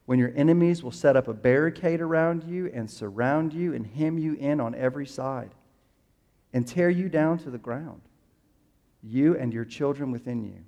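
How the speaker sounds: measured, solemn reading of scripture; reading the passage aloud to the congregation, solemn. Acted. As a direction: read it like the Gospel lesson, steady and grave.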